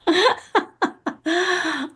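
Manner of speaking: high, sustained laughter. Real, not acted. Host inviting guest back to tell us, high laugh. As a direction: let out a long high laugh.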